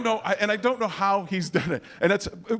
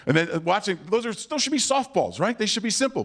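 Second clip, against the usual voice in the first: usual speaking voice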